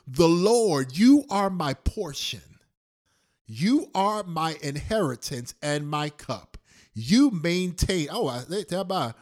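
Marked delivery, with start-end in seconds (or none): 0.1-8.1 loud preaching of scripture. Acted. Preacher proclaiming the psalm to the congregation, loud and fervent. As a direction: proclaim it to the whole church.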